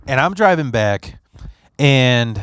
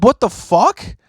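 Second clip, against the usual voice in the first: much higher, louder outburst of shock; startled friend; 11 semitones higher, 4 dB louder than usual. Retold or imitated. Friend startled to find him outside at 3 AM, much higher. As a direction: caught off guard at three in the morning.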